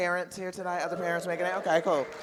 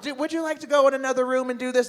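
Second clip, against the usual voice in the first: annoyed TSA agent; 7 semitones higher, 6 dB louder, much faster than usual. Retold or imitated. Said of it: "She's like".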